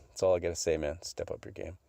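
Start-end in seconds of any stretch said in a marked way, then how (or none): 1.0-1.8 whispered, repeating "Step up your game" softly. Real, not acted. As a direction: breathe the line as a secret aside. Repeated tease, whispered take.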